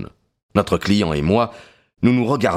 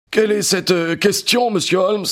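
frightened; 12 semitones higher than usual. Acted. Cornered villain fears exposure.

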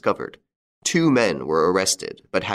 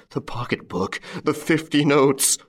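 higher, drawn-out moan of despair; 7 semitones higher than usual. A narrator's reading. Robbed husband listing what is lost, moaning. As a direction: moan it slowly, pitch lifting. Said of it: "he moaned".

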